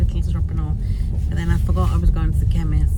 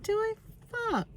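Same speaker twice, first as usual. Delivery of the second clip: mock squeal; 10 dB quieter than usual. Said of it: "No, I didn't actually swear".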